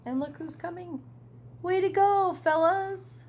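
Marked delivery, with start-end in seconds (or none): 1.6-3.2 high, cheery praise; proud praise. Acted. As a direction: congratulate the team. Big Wheels McGee praises team.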